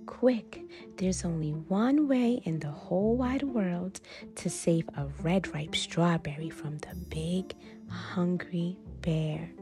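ordinary delivery, nothing marked

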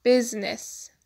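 word spoken loud for voice search; loud demonstration. Shown as a demonstration. Showing viewers the dictionary's voice lookup, loud. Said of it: "بلند بگید".

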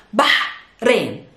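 Bah- ending in a strong exhaled h, then Rain apart; Bah- with a long voiceless h, pause, Rain; breathy Arabic ha. Shown as a demonstration. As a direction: split Bah- and Rain, pushing air on the h. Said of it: "There's a ha sound".

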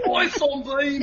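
high, laughing British "oi"; amused fake British call; mock British accent. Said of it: "That's a British accent".

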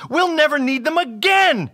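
high, loud exclamation; elated storybook hero; excited. A narrator's reading. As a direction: burst out with the good news. Excited Bob with news.

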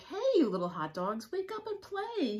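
loud, rising puppy call; barking dog call. Acted. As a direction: call out like a pup waking friends. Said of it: "he barks".

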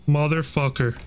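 ordinary delivery

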